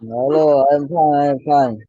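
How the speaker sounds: normal talking voice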